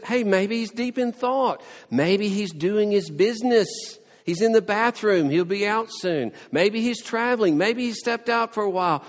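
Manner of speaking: high, loud jeering suggestions; mocking. Elijah jeering at Baal's priests, high mocking taunt. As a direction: taunt with mock helpfulness. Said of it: "he's mocking their idea of a god".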